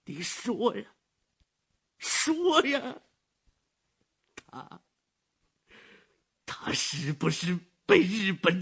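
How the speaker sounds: high, loud, slow desperate pleading; a terrified father begging for the truth; frantic old man. Acted. (惊恐哀求的老人)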